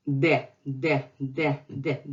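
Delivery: de with a hard Russian D. Shown as a demonstration. Teacher showing Russian against English, hard de. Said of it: "and Russian is".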